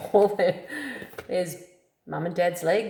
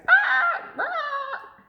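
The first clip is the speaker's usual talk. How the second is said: very high squawking cries; bird squawks; 4 dB louder than usual. Acted. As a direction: squawk like an upset cockatoo. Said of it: "she's going".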